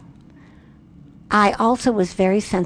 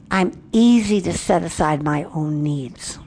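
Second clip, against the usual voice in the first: usual speaking voice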